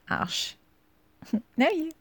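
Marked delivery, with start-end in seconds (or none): 0.1-0.5 Arsch said clearly, German ah with sh; German demo. Shown as a demonstration. Teaching her partner German: Arsch spelled out clearly. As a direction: model the word Arsch for him to copy. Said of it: "just repeat after me".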